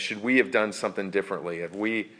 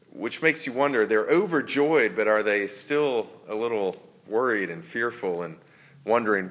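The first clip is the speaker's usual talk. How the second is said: ordinary delivery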